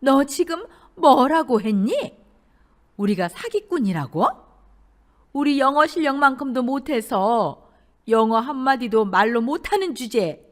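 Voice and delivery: outraged shouting. A narrator's reading. Mother-in-law shouting at the bride, outraged. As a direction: raise your voice in outrage. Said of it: "얼굴이 벌개져서 소리쳤죠".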